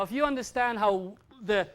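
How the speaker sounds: normal talking voice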